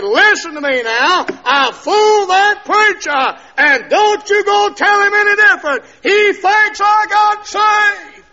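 loud, high, slowing shout; angry threat. Retold or imitated. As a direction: bellow the warning at her. Drunk husband threatening wife.

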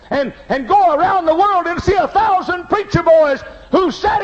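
rising shout to a high preaching peak; preaching at full force. Acted. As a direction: build it to a shouting climax.

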